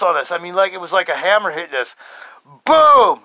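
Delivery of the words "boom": loud, high boom; explosive exclamation. Retold or imitated. Telling how the spirit hit them, explosive. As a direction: hit it like a hammer blow.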